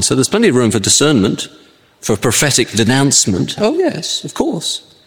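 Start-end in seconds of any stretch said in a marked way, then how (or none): 3.6-4.9 much higher, airy "oh yes, of course"; mock eager agreement. Preacher mimicking a listener's eager agreement, high and airy. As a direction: toss it off like a too-keen listener agreeing.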